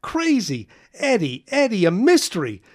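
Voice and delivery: high and loud, tumbling over himself; a kid raving about the mystery stink; breathless excitement. Acted. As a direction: gush it out, barely containing it. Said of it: "cried Carl".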